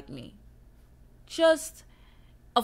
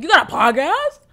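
high, loud amazed question; a stranger loudly shocked she has a podcast; surprised question; 9 semitones higher, 16 dB louder than usual. Retold or imitated. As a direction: sound amazed at the news. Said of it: "I be getting embarrassed when people like".

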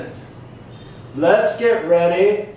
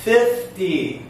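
first syllable of Fifty raised, second falling; stress on FIFty; 3 semitones higher than usual. Shown as a demonstration. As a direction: punch FIF, then let ty drop. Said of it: "Do you hear the syllable".

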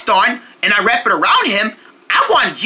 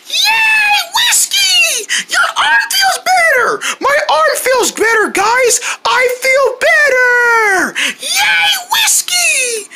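excited cheering; slower than usual. Acted. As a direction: cheer like the best news ever. Cheering the healed arm.

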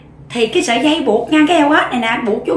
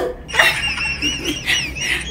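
high, long burst of laughter; long high cackle; 7 dB quieter than usual. Real, not acted. Vlogger doubled over at her own story, long high cackle.

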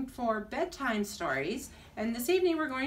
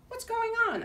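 high, curious lizard voice; a small creature puzzling aloud; lizard wondering; 13 semitones higher than usual. Acted. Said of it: "Oscar wondered".

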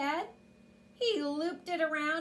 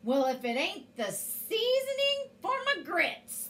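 higher-pitched storybook giant shout; giant's shout; 7 semitones higher than usual. A narrator's reading. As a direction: call it out as a menacing giant. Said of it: "the giant shouted".

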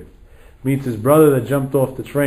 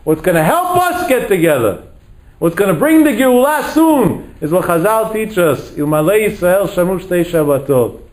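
high, ringing sermon climax; fervent rabbinic closing appeal; impassioned preaching; 6 semitones higher, 3 dB louder than usual. Real, not acted. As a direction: exhort the congregation, pitch held high.

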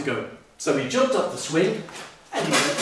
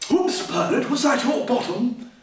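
lightly voiced bear; 7 semitones higher than usual. Bear character voice.

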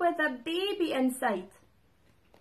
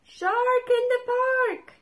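high, loud full shout; shout at the top of his voice; 10 semitones higher, 7 dB louder than usual. A narrator's reading. Tim yelling his warning across the park, loud and high. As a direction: bellow it out to warn everyone. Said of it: "he shouts ... at the top of his voice".

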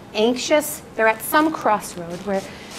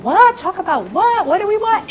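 high, loud, baffled what; mock panic, voice shooting up; alarmed brother; 9 semitones higher, 6 dB louder than usual. Brother calling her, high and alarmed.

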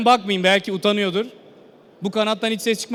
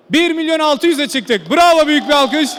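excited; 5 semitones higher, 4 dB louder than usual. Real, not acted. Auction price climbs, calls applause.

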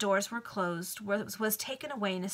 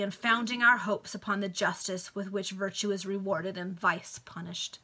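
plain reading voice

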